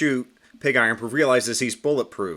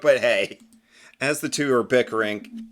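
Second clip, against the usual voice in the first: ordinary delivery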